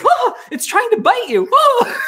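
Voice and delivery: high laughing mock alarm; imitating panic. Mocking Dwayne's fright as Chad dangles the snake. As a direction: yelp it up high while laughing. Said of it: "Dwayne's going".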